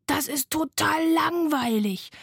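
a hint of a bored, exasperated sloth; sloth complaining. A narrator's reading. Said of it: "sagte das Faultier".

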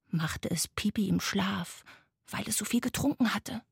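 ordinary delivery

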